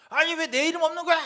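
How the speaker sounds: high, loud yelling elder; angry shout. Retold or imitated. Elder yelling at the clerk over a missing name, angry shout. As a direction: bellow it like an offended church elder. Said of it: "이렇게 소리를 지르는 거야".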